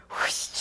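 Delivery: voiceless rushing whoosh. Retold or imitated. Host describing the Colosseum filled with gladiators, imitated whoosh. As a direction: blow out an airy whoosh noise.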